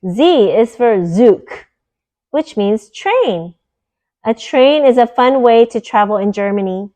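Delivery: bright, clear storybook read-aloud voice; reading to children. Acted. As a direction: read it like a bedtime picture book for kids. Reads picture book aloud.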